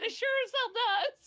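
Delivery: high, loud retort broken by laughter; laughing through a cheeky retort. Retold or imitated. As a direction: fire it back while laughing hard. Laughing comeback to daughter.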